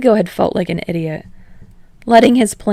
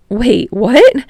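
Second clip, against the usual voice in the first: higher, louder startled question; surprised; 6 semitones higher, 7 dB louder than usual. Acted. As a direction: jolt up in surprise. Kirishima surprised.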